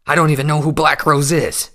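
desperate, loud denial; loud protest. A narrator's reading. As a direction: push back hard in protest. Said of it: "Stoneshot protested".

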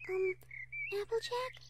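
timid, high, soft query; small hesitant voice seeking attention; Fluttershy. Acted. Fluttershy nudging Applejack from her thoughts, timid and soft.

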